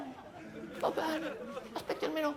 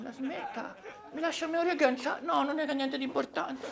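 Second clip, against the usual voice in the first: ordinary talk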